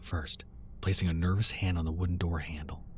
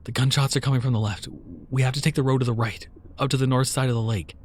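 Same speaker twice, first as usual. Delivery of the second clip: raised, commanding voice; urgent and firm; 5 semitones higher, 5 dB louder than usual. Acted. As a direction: take charge. Giving urgent directions.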